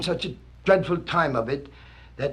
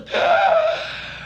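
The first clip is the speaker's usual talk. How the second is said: sharp high breathless gasp; dramatic gasp. Acted. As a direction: gasp as if startled. Gasp at the bird landing.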